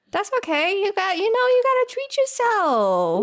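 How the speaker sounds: very high, playful cooing voice; high-pitched indulgent encouragement. Pretend. Host excuses friend's takeout splurge, high playful reassurance.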